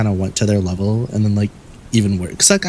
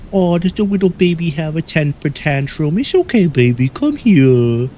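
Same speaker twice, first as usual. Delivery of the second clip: high, louder mock-soothing baby voice; baby talk; 8 semitones higher, 4 dB louder than usual. Taunting the classmate who hit him, cooing high and loud. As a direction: coo at him like a cranky infant. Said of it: "talk to them like babies".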